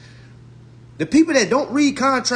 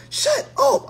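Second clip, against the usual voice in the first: high exasperated shut up. Real, not acted. Exasperated dismissal.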